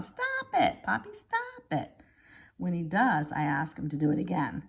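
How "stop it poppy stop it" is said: squealing playful yell, much higher. Retold or imitated. Child squealing as grandpa chases with hose, playful yell. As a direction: shriek at grandpa through the hose spray. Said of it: "I yell".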